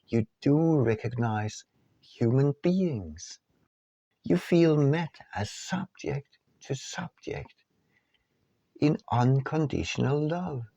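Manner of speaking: ordinary delivery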